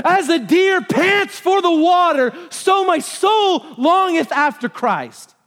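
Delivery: high, slow, forceful shout; loud, drawn-out desperate longing; desperate panting cry. Acted. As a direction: cry it out like a hunted deer. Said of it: "he's panting like this".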